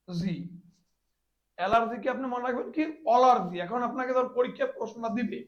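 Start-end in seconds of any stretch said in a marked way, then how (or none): none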